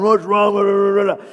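high, muddled drive-thru noise; garbled speaker voice. Acted. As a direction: imitate unintelligible speech through a speaker. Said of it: "as awful as they sound to you coming through that speaker".

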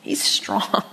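low unvoiced growl of strength; growly aside. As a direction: growl it like a lion. Low growly aside after lion verse.

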